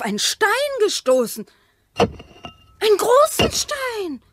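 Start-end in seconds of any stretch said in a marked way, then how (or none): 2.0-4.2 high, slow, wondering exclamation; amazed discovery. Acted. Wife showing her husband the big stone she struck, amazed. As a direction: marvel at what the hoe turned up.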